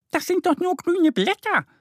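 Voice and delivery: very high little duck voice objecting; duckling retorting. A narrator's reading. Duck Annie objects to grandpa, very high voice. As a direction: talk back as a cheeky duckling. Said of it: "entgegnet Annie".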